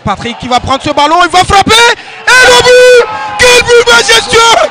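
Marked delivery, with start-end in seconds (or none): 1.2-4.6 goal call, high and loud; excited sports commentary. Real, not acted. Goal scored, commentator erupts.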